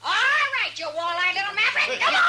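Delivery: rowdy laughing yell; laughing shout. Acted. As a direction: bellow it with a laugh, throwing him out. Saloon owner hollers at rowdy cowboy.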